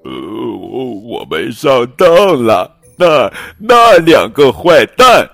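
funny muffled voices. Acted. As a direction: sputter it out in an odd voice. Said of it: "他们的声音听起来怪怪的". (声音怪怪的，越来越高越响)

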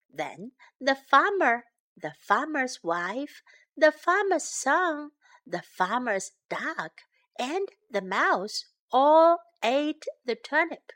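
usual speaking voice

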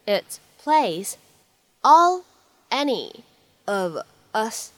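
very slow, each chunk set apart with pauses; slow phrase-by-phrase reading. Shown as a demonstration. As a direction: break the line into chunks slowly, like a teacher. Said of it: "需要注意的发音技巧". (老师逐词慢读示范)

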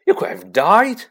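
loud, acted tearful outburst; Hagrid sobbing. A narrator's reading. Giant blames himself at boy's bedside, sobbing. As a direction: wail it, rising at the end. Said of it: "sobbed Hagrid".

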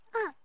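sudden scared yelp; frightened cry. A narrator's reading. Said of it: "叫了起来".

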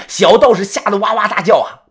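ordinary delivery

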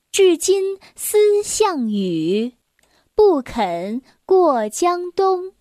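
slow, loud, stately recital; poem recitation. Acted. Reading a Song poem to young listeners, slow declamation. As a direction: declaim each phrase slowly and firmly.

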